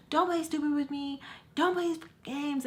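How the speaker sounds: high-pitched scolding mom imitation; cussing mom. Mom accusing her kid over the phone, high angry mimicry. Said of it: "she is cussing me out".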